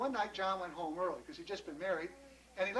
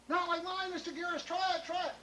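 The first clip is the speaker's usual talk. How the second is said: very high, pleading excitement; eager insistence; 10 semitones higher than usual. Acted. John begging Mister Garris to taste, insistent. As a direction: insist keenly, refusing no.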